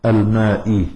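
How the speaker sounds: الماء with a final "i", tune falling; الماء closed on a falling kasra; genitive ending. Shown as a demonstration. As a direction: recite الماء as al-maa'i, kasra sounded clearly. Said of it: "إلى الجر".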